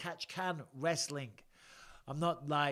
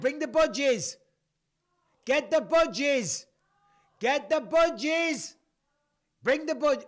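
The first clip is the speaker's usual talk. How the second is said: calling out; 11 semitones higher, 11 dB louder, slower than usual. Real, not acted. Calling to his wife offscreen.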